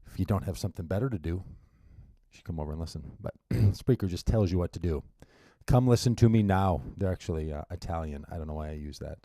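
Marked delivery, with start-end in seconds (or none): 5.7-6.8 mild mock Austrian accent, louder and commanding; a playful Austrian order, barked out a bit louder; bossy Austrian accent. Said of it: "I get bossy and I turn Austrian".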